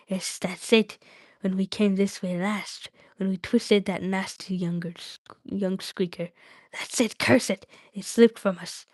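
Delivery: agitated hissy creature voice, dropping into whispers; wretched creature talking to itself; Gollum, distraught. Acted. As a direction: curse and fret over a lost treasure.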